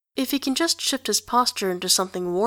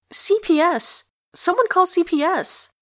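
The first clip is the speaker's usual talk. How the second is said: playful yell; 10 semitones higher, 4 dB louder than usual. Acted. Kid yelling, play-fighting.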